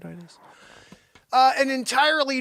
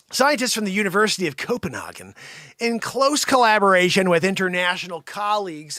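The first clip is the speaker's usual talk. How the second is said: ordinary delivery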